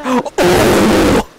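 loud mock "oh!"; exaggerated loud exclamation, imitating her; imitated gasp. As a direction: mimic her startled cry loudly. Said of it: "She, she's just like".

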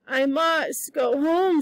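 slow, dull insistence; slow stubborn repeat. Acted. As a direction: say it slowly, stubborn and dazed. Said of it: "said Diana".

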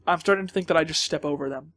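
usual speaking voice

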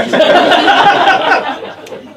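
high, loud hoot of laughter; a big delighted hoot. A narrator's reading. Harmony laughs at the birth.

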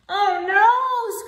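high, loud cry of alarm; screamed. Acted. Mole and Bear screaming as the kite flies off. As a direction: shout out in dismay. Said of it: "screamed Mole and Bear".

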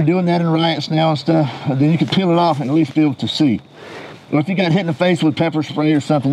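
muffled behind respirator; speaking through voice diaphragm; through a gas mask. Real, not acted. Seen 3: Full-face gas mask with filter worn throughout all frames.

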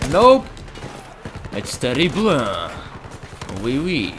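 hammed-up, badly faked French accent. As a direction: play at being French with a clumsy accent.

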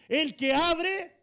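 loud, high, drawn-out proclamation; ringing, slow pulpit cry; emphatic scripture reading. Real, not acted. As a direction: stretch each word for the congregation. Reads scripture to rouse congregation.

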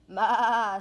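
very loud goat bleat. Acted. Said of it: "said a goat".

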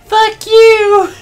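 very high, screamed rage; furious scream. Pretend. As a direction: scream it at the top of your lungs. Voicing an enraged son in-game.

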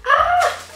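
short, high shriek; very excited squeal. Real, not acted. Fan admiring the album, very excited squeal. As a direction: burst out in a fan scream. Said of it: "I'm very excited, if you couldn't tell".

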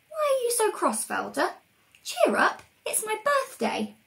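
high-pitched little character voice; Treacle. Acted. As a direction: pipe up to cheer a friend. Voice of Treacle, cheering Velda up.

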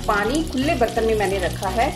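ordinary talk, nothing marked

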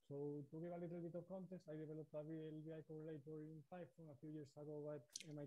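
very faint, barely audible voice. Real, not acted. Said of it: "you're actually growing quieter and quieter".

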